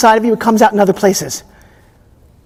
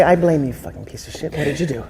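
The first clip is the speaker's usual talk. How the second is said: modelled accusation; 7 semitones lower, 7 dB quieter than usual. Shown as a demonstration. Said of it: "Look at him and say".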